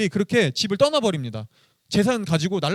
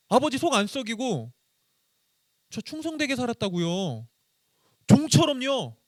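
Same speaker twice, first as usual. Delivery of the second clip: bitter complaint; 8 semitones higher than usual. Retold or imitated. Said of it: "첫째 아들은 아빠한테 이렇게 얘기하고 있었던 거예요".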